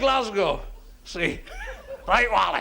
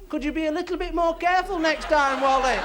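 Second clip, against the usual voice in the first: mock polite; 7 semitones higher, 6 dB louder than usual. Scalded workman's mock polite reply.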